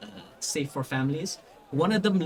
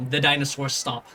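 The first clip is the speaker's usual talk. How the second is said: ordinary talk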